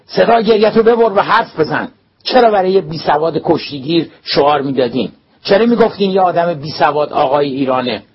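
loud, high, furious demand; angry roar. A narrator's reading. Principal demanding answers from the crying boy, furious. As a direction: bark the questions in rage.

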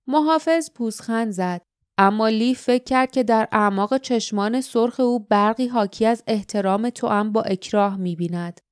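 usual speaking voice

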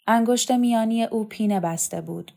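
plain reading voice

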